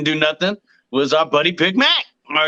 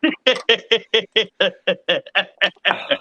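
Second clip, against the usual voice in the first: a long real laugh at the story. Real, not acted. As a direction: laugh it out, rising.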